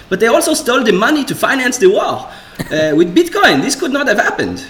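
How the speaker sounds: Israeli Bitcoin shill, singsong with hesitant "uh"s; mock Israeli accent, swinging up and down in pitch. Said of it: "a Bitcoin shill in an Israeli accent".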